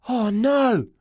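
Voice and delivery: a small groan of doom; dismay. A narrator's reading. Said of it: "I say".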